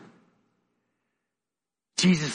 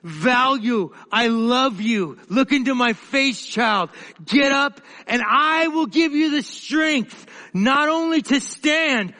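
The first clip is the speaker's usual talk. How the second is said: higher, rising preacher's cry with swells on key words; fervent preaching; 5 semitones higher than usual. Acted. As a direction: preach it out, pleading and lifting the room. Preacher builds to fervent appeal.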